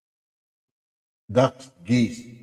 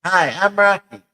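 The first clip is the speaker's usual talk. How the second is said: high, loud cartoon greeting; Rocky voice; 11 semitones higher, 7 dB louder than usual. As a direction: bounce in high and bright as a puppet. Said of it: "skit with Rocky and Raquel".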